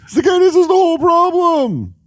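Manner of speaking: Seinfeld impression. Imitating Seinfeld for a friend. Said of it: "Or think about Seinfeld".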